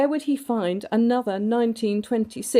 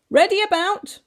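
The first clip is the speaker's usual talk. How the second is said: sailing call; 13 semitones higher, 6 dB louder than usual. A narrator's reading. Sailing calls.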